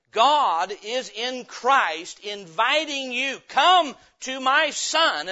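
slow invitation. Acted. Preacher's slow pleading invitation.

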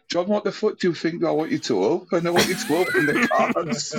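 half-laughing, fed up with John; exasperated laughing. Retold or imitated. Retold exasperated outburst, laughing.

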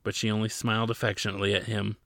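ordinary delivery, nothing marked

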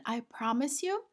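normal talking voice

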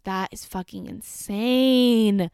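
slow, loud, stunned disbelief. Real, not acted. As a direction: drag it out, appalled. Reacting to the murders.

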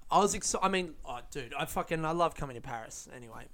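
normal talking voice

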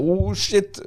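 high, stunned mock gasp; retelling his shock at losing the fish; shocked oh shit. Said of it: "Jag bara".

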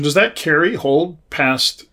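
ordinary delivery

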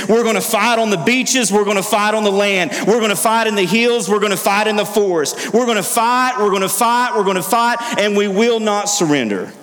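high, ringing wartime oratory; rally the nation to fight; roaring rallying speech. Retold or imitated. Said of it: "he says".

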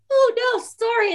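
high, sweet mock-innocent apology; feigned innocence. To gate staff, a high playing-dumb apology. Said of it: "I pretend not to know it".

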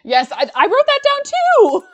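high, loud burst of real excitement; thrilled squeal of agreement; excited agreement. Real, not acted. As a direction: blurt it out, soaring in pitch. Delighted they noted the same quote.

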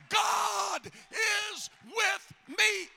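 high, drawn-out cry of "God is with me"; climactic preaching shout. Real, not acted. Preacher declaring faith at the sermon's peak, climactic shout. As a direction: belt it out slowly, each word held high.

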